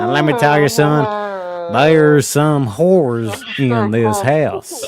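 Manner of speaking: low, slow Southern drawl, stretched out; Southern drawl on the punchline. Acted. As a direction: drag it out like a Southern preacher. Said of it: "I like the, uh, southern drawl there".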